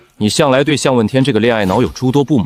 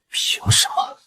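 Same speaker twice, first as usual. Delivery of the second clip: whispered bitterness; 7 dB quieter, slower than usual. Acted. Bitter quiet question.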